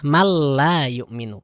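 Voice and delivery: slow, "Mal la" with the n fully merged into l; merged reading. Shown as a demonstration. Teacher demonstrates idgham without nasal hum. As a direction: stretch "Mal la yu'minu" evenly. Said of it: "Contoh seperti itu".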